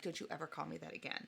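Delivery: low, soft, fast threat; menacing low warning. Pretend. Chablis warning him never to deadname her, low and soft. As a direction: hiss it as a quiet warning. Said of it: "be like".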